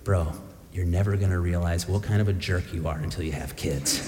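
low, soft and fast, leaning in close; confiding aside. Retold or imitated. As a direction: drop it low, like a secret warning. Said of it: "pulled me in a little closer and he said".

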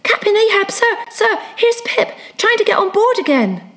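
high-pitched excited plea to the captain; urgent calling. Acted. Crazed Pip reporting to Captain Ahab, high urgent call.

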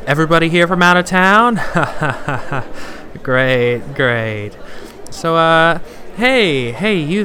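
loud, high emcee greeting; stage host greeting. Acted. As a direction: work the crowd like an emcee.

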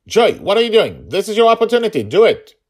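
high, loud urging cry; urgent shout. A narrator's reading. Wounded Dragonfather urges his daughter to fire, loud shout. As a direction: push her to act now.

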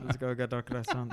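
mock French accent with a laugh; laughing, hammed-up French accent. Shown as a demonstration. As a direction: put on a jokey French accent. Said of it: "I'll try to do the French".

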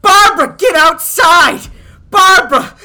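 high, loud excited shouting; excited yelling. Retold or imitated. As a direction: yell across the street in wonder. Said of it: "it's just going".

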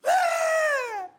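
put-on high piercing wail like a toddler; child's tantrum scream. Child screaming in Walmart over a toy, tantrum wail. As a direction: shriek like a kid denied a toy.

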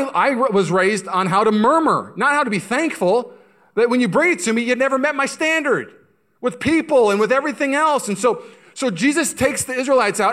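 high, loud preaching; impassioned preaching. Real, not acted. Preacher contrasts murmurers with the thankful, loud impassioned preaching. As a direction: drive the contrast home at full voice.

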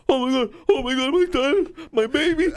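high, frantic mock panic of a father; a dad crying out for his choking baby; panicked dad.

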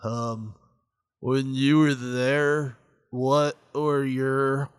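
very slow, halting interviewer impression; Hannibal. Mocking a horrible interviewer's questions, drowsy slow impression. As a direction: drag each question out painfully slowly. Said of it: "being a horrible interviewer".

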